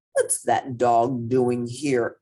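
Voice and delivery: low, slow stern principal voice. Acted. The principal demanding about the dog, low and stern.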